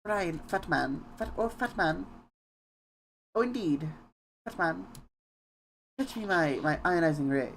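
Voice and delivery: put-on a light old Hollywood transatlantic voice; transatlantic accent. Shown as a demonstration. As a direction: play a glamorous old Hollywood star, transatlantic. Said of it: "the transatlantic accent".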